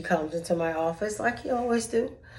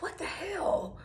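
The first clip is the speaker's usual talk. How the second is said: breathy high whisper, half to herself; whispered what the hell; 9 semitones higher, 12 dB quieter, slower than usual. Retold or imitated. Voicing the puzzled coworker, a soft high whisper. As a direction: mouth his baffled reaction under the breath. His confused reaction, whispered.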